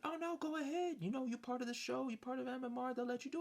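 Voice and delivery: high-pitched coaxing friend voice; mimicking the guy. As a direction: squeak it out like an eager buddy. Friend urging him onto slopes.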